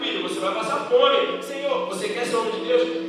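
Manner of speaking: impassioned pulpit shouting; full-force preaching. Acted. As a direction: preach it out with rising fire to the congregation.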